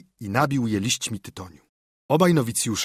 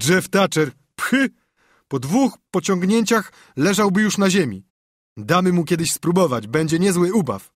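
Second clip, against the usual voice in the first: "Jeff Thatcher" repeated higher and louder, with disdain; scornful scoff; 6 semitones higher, 4 dB louder than usual. Acted. Joe mocks Jeff to Tom, scornful and louder. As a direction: spit out "Jeff Thatcher" mockingly.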